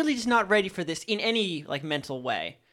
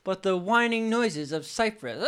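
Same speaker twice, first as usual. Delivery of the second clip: ordinary talk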